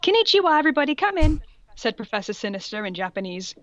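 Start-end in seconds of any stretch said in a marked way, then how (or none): 0.0-1.4 high, loud ladylike greeting; higher, louder perky woman; lady teacher voice. Shown as a demonstration. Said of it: "this is a lady".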